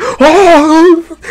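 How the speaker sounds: high, loud "Oh!" burst; hammed-up shriek of an overexcited reactor; mock anime-fan gasp. Mocking fake reactors with a big shrill "Oh!". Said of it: "they be going crazy".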